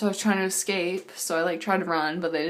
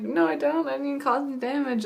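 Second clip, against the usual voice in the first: higher, indignant denial; indignant protest; 6 semitones higher than usual. Retold or imitated. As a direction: protest your innocence. Protesting the demand.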